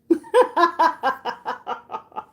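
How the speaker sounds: high, loud burst of laughter; real laugh. Real, not acted. Cracking up at herself over eating a whole squash, loud high laugh. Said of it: "I'm just laughing".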